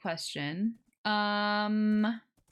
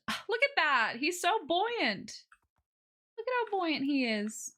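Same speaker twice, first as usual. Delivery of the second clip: delighted; 7 semitones higher than usual. Real, not acted. Player watching horse float on leash, delighted.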